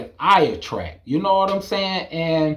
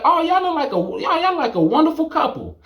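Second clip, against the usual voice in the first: admiring stranger; 13 semitones higher than usual. Retold or imitated. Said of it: "Somebody could say".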